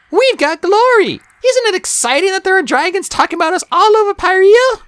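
high, loud, eager character voice; a touch dreamy, high-pitched enthusiasm. A narrator's reading. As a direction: marvel at it in a high voice. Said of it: "she added dreamily".

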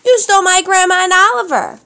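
angry accusation. A narrator's reading. Melissa accuses the pirate.

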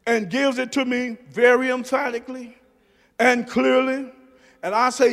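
ordinary talk

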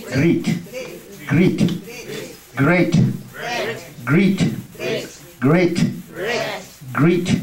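"Great" repeated carefully as a drill; teacher drilling "Great" with the class; model pronunciation. Shown as a demonstration. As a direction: say "Great" clearly over and over. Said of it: "Chữ này đọc là gì?".

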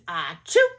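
mock sneeze. Cow sneezes again.

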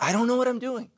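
high-pitched confession at normal volume; pleading admission. Shown as a demonstration. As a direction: admit it helplessly, voice lifted high. Models admitting it after the yells.

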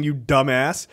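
ordinary delivery

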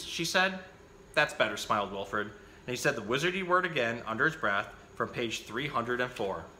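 put-on lively picture-book reading for children; animated bedtime-story reader; story narration. A narrator's reading. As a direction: read the story out with bouncy, emphatic phrasing.

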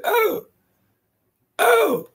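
very high falsetto holler; repeated falsetto hoots, mocking. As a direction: mimic his hollering way up high. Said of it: "He started hollering".

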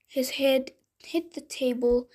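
plain reading voice

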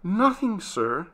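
timid servant's reply; faltering. Acted. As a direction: falter a little, as if scared of the question. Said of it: "she faltered".

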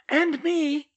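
put-on high, young girl's chirp; piped up. Astoria volunteering to fight, piping up high. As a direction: pipe up eagerly. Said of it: "Astoria Greengrass piped up".

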